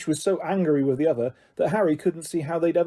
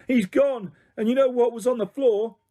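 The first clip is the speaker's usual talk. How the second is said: angry shouting; 7 semitones higher than usual. Acted. Ron furious about Scabbers.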